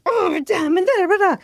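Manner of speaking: high, loud mock rant; mock yelling. Mom imitating her own meltdown, mock yelling. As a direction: flail and yell in a hammed-up fit. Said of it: "I'm yelling".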